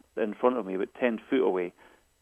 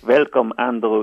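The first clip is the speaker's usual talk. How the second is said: a louder, hammed-up vampire greeting, lightly voiced; mock Count Dracula; 9 dB louder than usual. Boyfriend greets a guest over the intercom, joking Dracula voice. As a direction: ham up a joking Dracula welcome at the door. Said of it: "he says in his best Count Dracula accent".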